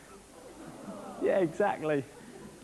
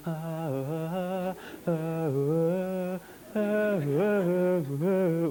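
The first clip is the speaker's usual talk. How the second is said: drawn-out staccato ahs building suspense; tense chanting ah; much slower than usual. Retold or imitated. Narrator to audience, chanting a western standoff ah ah.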